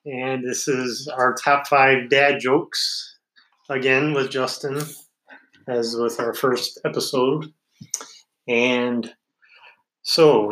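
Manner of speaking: usual speaking voice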